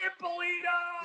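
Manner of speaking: high, drawn-out summons; calling out. Acted. Casino GM calls for the employee behind it, hollering. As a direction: stretch the name out in a high holler. Acts out GM yelling his surname.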